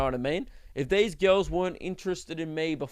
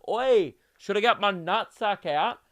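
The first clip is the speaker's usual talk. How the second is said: a lightly voiced rowdy drunk local, a bit higher and louder; rowdy drunk bloke; 4 semitones higher, 3 dB louder than usual. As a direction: throw it out like a cheeky drunk at the pub. Drunk local calls out at bar.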